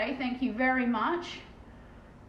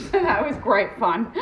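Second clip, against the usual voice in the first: laughing; 4 semitones higher, 8 dB louder than usual. Real, not acted. Laughing about abuse.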